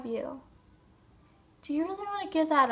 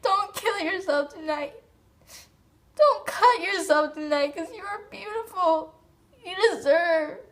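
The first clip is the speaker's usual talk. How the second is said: real crying, higher-pitched desperate plea; tearful pleading; 3 semitones higher than usual. Real, not acted. Speaker begging a suicidal viewer to spare their life, crying. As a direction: beg them through sobs, voice lifted.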